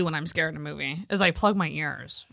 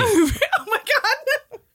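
slow, high, through laughter; laughing; 13 semitones higher, much slower than usual. Real, not acted. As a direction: let the laugh stretch the words out. Laughing at costume suggestion.

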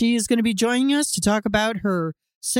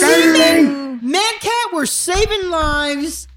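higher, louder, half laughing; mock heroic outcry; 6 semitones higher, 4 dB louder, much slower than usual. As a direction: cry it out like a hammy hero.

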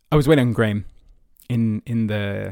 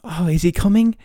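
put-on higher, eager kid voice from a Christmas ad; imitating a kid asking about Santa, a bit higher; mock TV ad kid; 7 semitones higher than usual. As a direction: play the excited child in the ad. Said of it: "Everything you see on T V is like".

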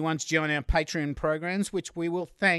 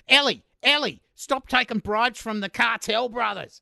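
scolding teacher; 6 semitones higher than usual. Pretend. Imagined teacher scolding a child.

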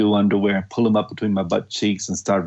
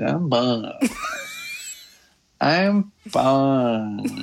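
exaggerated whine; 5 semitones higher, much slower than usual. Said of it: "really exaggerated".

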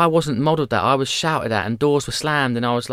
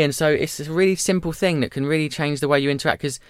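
normal talking voice

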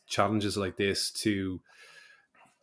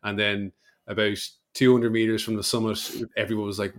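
ordinary delivery

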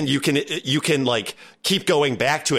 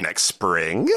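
ordinary talk

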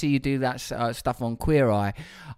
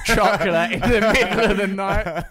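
louder, higher, laughing through it; father laughing at his own indulgence; 8 semitones higher, 6 dB louder than usual. Real, not acted. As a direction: laugh at your own parenting. Laughing at himself.

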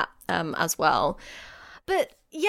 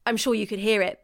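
ordinary talk

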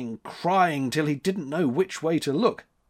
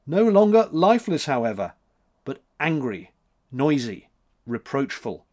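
usual speaking voice

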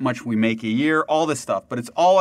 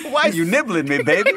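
higher, playful put-on voice; mock sultry turtle banter; 4 semitones higher, 3 dB louder than usual.

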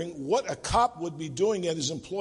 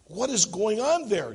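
higher, apprehensive demand; 5 semitones higher, 3 dB louder than usual. Acted. Boss confused by the strange call, demanding. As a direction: press for an answer with rising alarm. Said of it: "demanded the boss".

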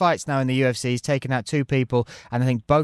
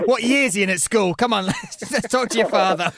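higher-pitched teasing grown-up voice; mock concerned parent; 7 semitones higher than usual. Co-host teasing the love-letter writer, mock-parental. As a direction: play the worried parent questioning a child.

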